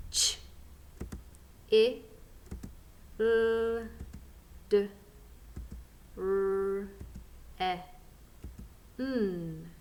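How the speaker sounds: Ch, I, L, D, R, E, N as separate sounds with pauses; sounding out. Shown as a demonstration. Teacher invites learners along, sounding out each sound. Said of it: "Sounding out with me".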